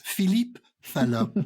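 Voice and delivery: hammed-up French accent; mock French accent. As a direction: say the name like a Frenchman. Punchline repeated in French voice.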